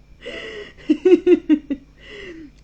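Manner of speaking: high, loud laugh tipping into crying; helpless tearful laughter; laughing till tears. Real, not acted. Son laughs himself to tears over the joke, high and loud.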